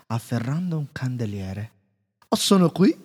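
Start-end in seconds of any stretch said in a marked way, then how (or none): 2.3-3.0 high, loud, chirpy reply; cheerful candlestick. A narrator's reading. Candlestick answering the frightened inventor, high and loud. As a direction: pop up eagerly.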